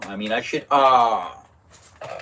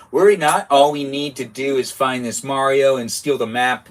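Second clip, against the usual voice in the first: bold witch assurance; 4 dB louder than usual. Acted. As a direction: declare it boldly, pitched up. Reads confident witch line.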